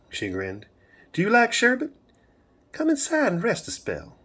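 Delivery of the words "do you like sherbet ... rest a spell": high-pitched, sing-song Southern lilt; Southern twang. A narrator's reading. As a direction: tempt him in a high Southern voice. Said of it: "that beautiful Southern twang".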